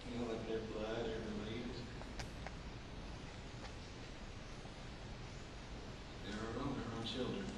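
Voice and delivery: barely above a murmur, weighed down; quiet, grieving confession; hushed grief. Acted. Recalling losing his students.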